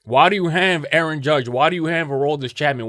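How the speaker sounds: ordinary talk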